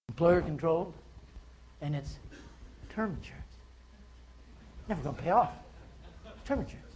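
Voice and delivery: soft, knowing asides with long pauses; demonstrated sales expression. Shown as a demonstration. Agent walking a prospect through term insurance, quietly pointed. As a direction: let each drawback land quietly. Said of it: "facial expressions".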